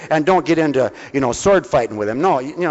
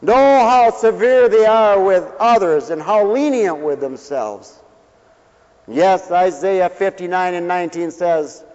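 loud, slow, pulpit-pounding sermon voice; fiery revival preacher; full-force preaching; 4 semitones higher, 4 dB louder, slower than usual. Acted. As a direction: preach it out to the whole congregation. Preaching opening, raised voice.

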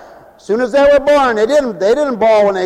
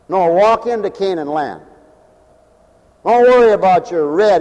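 ordinary delivery

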